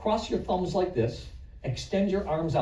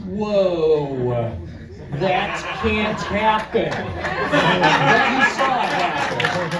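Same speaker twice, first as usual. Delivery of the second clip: loud, slow hammed-up amazement; mock astonishment; 3 semitones higher, 7 dB louder, much slower than usual. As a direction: play up the shock for the crowd. Magician feigns astonishment at trick.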